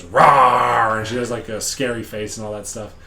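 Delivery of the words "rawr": mock monster roar. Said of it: "And the kid goes".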